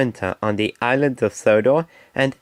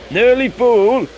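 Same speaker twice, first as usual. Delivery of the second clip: calling out; 9 semitones higher, 5 dB louder, slower than usual. Acted. Fireman shouting from tank.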